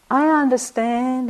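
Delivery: put-on high, odd foreign-sounding accent on I understand; weird accent. Kittisaro piping up in the teacher's support, weird accent. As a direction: put on a strange, unplaceable accent, a little high. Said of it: "weird accent".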